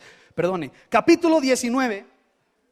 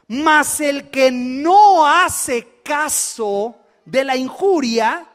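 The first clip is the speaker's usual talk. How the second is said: slow reading; 4 dB louder, much slower than usual. Shown as a demonstration. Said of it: "leámoslo con calma".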